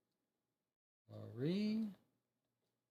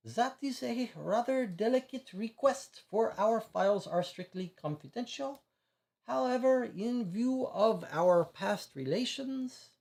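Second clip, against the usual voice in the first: put-on a higher-pitched French accent, careful and measured; 8 semitones higher than usual. Consul weighing a delicate request, in a French accent. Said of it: "I can't keep a French accent".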